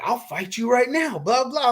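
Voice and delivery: high, hammed-up threat; mock angry challenge. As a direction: toss off his tough-guy challenge. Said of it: "he's like".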